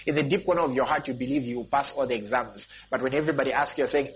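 ordinary talk, nothing marked

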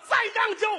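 high, loud mock call; mimicked helper's cry. Shown as a demonstration. Vendor shows when to come in, mimicked high cry. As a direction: imitate the helper's shrieked plea. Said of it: "那会儿你才来".

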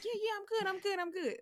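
high-pitched retold reassurance; insisting friend. Retelling friend's answer at the lake, high-pitched insistence. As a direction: protest high that all is well.

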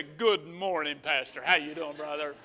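mock pious greeting; sweet churchy greeting. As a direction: switch to the nice church voice. Sudden polite greeting at church.

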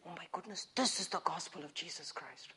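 very low, hushed aside; hushed awe. Real, not acted. Preacher marvels at the gospel, hushed and low. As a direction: drop almost to nothing in wonder.

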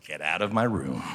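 low, understated dismissal; a touch of a woken-up father; mild grumpy dad. Acted. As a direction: send the kids out with low-voiced annoyance. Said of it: "That moment".

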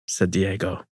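usual speaking voice

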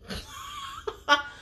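laughing. Real, not acted. Laughing about missing her therapist.

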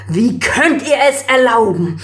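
furious emperor confronting intruder; outraged. A narrator's reading.

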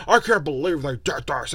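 mock outraged gamer. Mocking fans who rage-post at EA, whiny mock outrage. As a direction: parody a rage poster. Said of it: "don't go rage post and be like".

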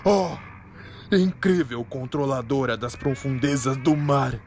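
lightly voiced begging hero; humble pleading. Troy pleading.

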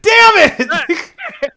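loud high yell of mock frustration; hammed-up angry outburst; mock angry shout. Mock outburst after joke.

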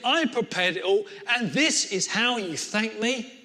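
rising angry tirade, loud and high; furious rant. Acted. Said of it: "And Cain was absolutely furious".

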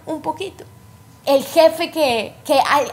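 ordinary talk

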